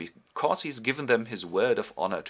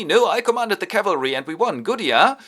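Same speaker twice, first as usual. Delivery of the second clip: loud, high-pitched, pleased with himself; brag, expecting praise; proud boast; 10 semitones higher, 9 dB louder than usual. Retold or imitated. Homburg boasts to the Duke.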